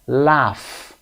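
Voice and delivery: "laugh" drawn out, ending in F; AUGH as AFF. Shown as a demonstration. Teacher shows learners the AUGH sound, final F. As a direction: slowly model "laugh" with GH as F. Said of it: "A U G H صدای ف".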